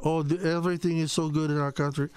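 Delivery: breezy fake French accent poking fun; mock French accent. Mocking the press secretary's cheery spin in a light French accent. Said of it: "in her little French accent".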